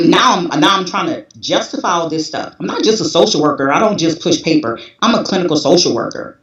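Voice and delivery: usual speaking voice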